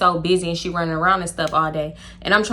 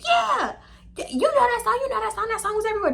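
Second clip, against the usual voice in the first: eager friend; 12 semitones higher, 5 dB quieter than usual. Retold or imitated.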